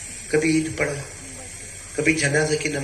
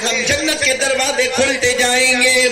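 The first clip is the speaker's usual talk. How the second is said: high-pitched, ringing sermon delivery; full-force preaching; 13 semitones higher, 5 dB louder than usual. Acted. Preacher telling worshippers of God's promise, high impassioned preaching. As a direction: proclaim it high to the whole crowd.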